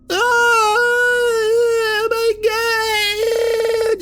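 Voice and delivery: super high-pitched shriek; blood-curdling scream. Retold or imitated. Girlfriend mauled by a bear, blood-curdling scream. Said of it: "blood-curdling screams of his girlfriend".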